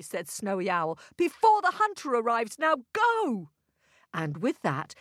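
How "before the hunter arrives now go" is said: raised, urgent warning; urgent owl. A narrator's reading. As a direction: hurry them off before danger comes. Owl urges haste before hunter comes.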